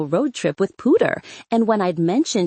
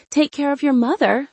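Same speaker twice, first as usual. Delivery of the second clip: calling out; 8 semitones higher than usual. A narrator's reading. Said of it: "she called".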